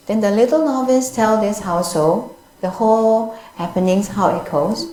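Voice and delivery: ordinary delivery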